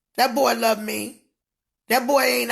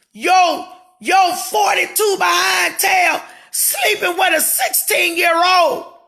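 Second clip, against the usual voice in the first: higher and louder, yelling the accusation; furious shout; 7 semitones higher, 5 dB louder than usual. Real, not acted. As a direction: scream it out in outrage. Furious exposé rant.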